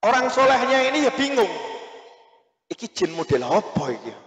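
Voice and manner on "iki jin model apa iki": bemused aside. Retold or imitated. Puzzled cleric thinks aloud.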